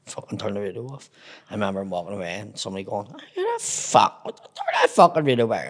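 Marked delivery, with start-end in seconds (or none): 3.1-5.7 calling after him. Retold or imitated. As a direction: imitate someone hollering after a man walking off. Retells somebody calling after man leaving.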